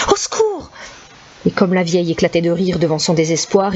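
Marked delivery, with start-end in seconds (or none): 0.1-0.7 high terrified cry; crying for help. Acted. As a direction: scream for help. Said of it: "cria-t-il". (appel au secours terrifié)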